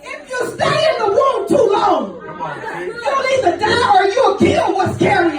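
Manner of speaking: preacher building to a cry; preaching. Acted. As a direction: drive the point home to the congregation. Preaching at full force.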